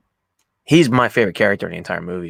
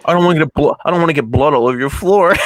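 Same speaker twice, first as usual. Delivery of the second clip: loud, raised mock warning; imitated tough line; 5 semitones higher, 7 dB louder than usual. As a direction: play a movie tough guy, loudly. Acted film threat quoted.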